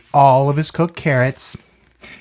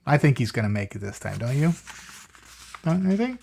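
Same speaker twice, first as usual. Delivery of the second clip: ordinary delivery, nothing marked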